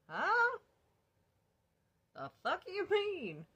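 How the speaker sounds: high, loud incredulous huh; reacting to unbelievable news, high and loud; shocked disbelief. Told by boyfriend he can't come home, loud shocked disbelief. Hearing he's not coming home.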